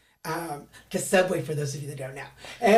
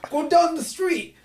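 doorman giving directions; 8 semitones higher than usual. Retold or imitated. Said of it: "and so he's like".

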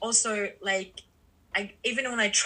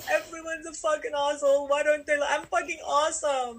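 higher, put-on voice of a sulking self; bitter grumbling inner voice; 6 semitones higher than usual. Imitating her past self alone, sulking and grumbling. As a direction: grumble at everyone as the petty old self. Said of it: "alone and be like".

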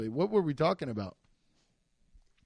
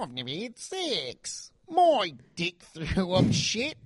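put-on slow Australian-accented voice; 4 semitones higher, slower than usual. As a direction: say it slowly in mock Australian. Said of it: "It's all nasal".

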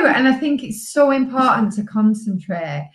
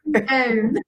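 laughing; 3 semitones higher than usual. Real, not acted. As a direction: laugh and choke up together. Laughing through the story.

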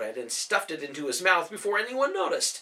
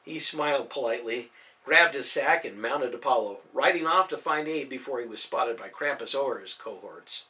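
usual speaking voice

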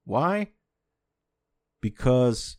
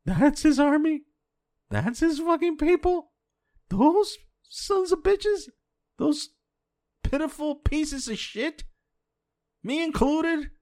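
pitched way up, exasperated disbelief; incredulous outburst; 11 semitones higher than usual. Real, not acted. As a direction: throw the questions up high in disbelief. Incredulous rant about Christians.

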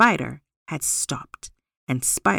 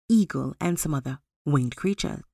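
ordinary delivery

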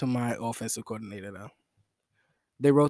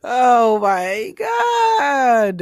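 high, loud laughing wail of disbelief; laughing shout; 10 semitones higher, 9 dB louder, much slower than usual. Real, not acted. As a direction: cry out through laughter. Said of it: "laughing at own line".

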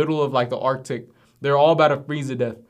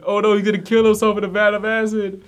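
higher, lightly voiced mock alarm; a touch of hammed-up panic, pitched up; 7 semitones higher, 3 dB louder than usual. Retelling the other Ricks panicking, higher mock alarm.

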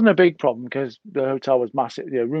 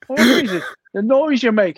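mock exasperation; 8 semitones higher, 5 dB louder than usual. Friend describing the noise.

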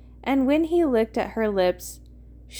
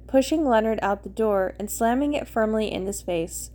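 ordinary delivery

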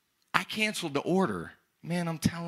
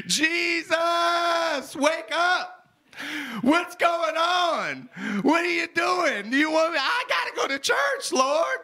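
panicked shout; 10 semitones higher than usual. Retold or imitated. Pleading with Jesus to wake up in the storm, high panicked shouting. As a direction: yell up at him like the boat's sinking.

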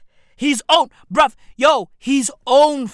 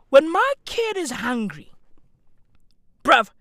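ordinary talk

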